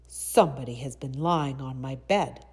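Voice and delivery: loud father bear, at a natural pitch; loud, gruff storybook bear; rough, gruff Papa Bear. A narrator's reading. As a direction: boom it out gruffly as the big bear. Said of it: "in his rough, gruff voice".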